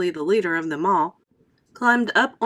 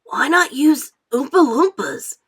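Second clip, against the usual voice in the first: slow, higher put-on boy voice; boy's question; 5 semitones higher, 6 dB louder than usual. A narrator's reading. Mike questions Wonka in a slow boyish voice. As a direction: ask it as a bored boy.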